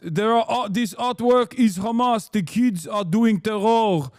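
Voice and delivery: thick put-on Israeli accent, pushed a little; mock Israeli envoy. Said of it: "the Israeli envoy who very famously".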